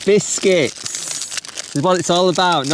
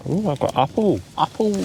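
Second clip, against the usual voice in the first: mouth full; 3 dB quieter than usual. Real, not acted. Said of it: "You're talking with your mouth full now".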